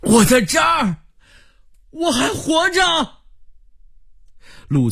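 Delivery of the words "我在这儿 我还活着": exhausted survivor calling out with all remaining strength; high, straining yell for rescue; all-out strained shout. Acted. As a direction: force the cry out high with your last strength. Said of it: "用尽全力喊道".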